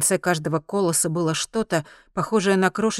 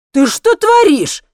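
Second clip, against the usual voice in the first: high, loud, drawn-out yell; outraged cry; 11 dB louder, slower than usual. A narrator's reading. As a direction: protest in shocked indignation. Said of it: "возмутился он".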